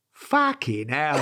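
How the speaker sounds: slow, laughing Cockney curse; imitating a Cockney exclamation; Cockney accent. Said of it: "in a perfect Cockney accent".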